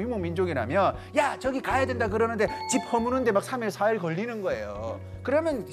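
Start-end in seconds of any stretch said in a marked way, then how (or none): none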